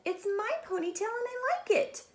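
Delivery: put-on high, proud little girl; high defiant girl; defiant Stephanie. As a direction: insist happily. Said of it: "Stephanie said".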